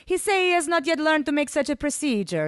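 put-on high, lilting accented apology; apologetic broken English; regretful. A narrator's reading. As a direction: break the news regretfully as translator. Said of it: "informed me regretfully".

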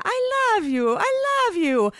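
high, loud, pleading declaration; hammed-up, almost wailing devotion; impassioned lover. A narrator's reading. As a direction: plead it melodramatically. Ivan declares love while kissing her.

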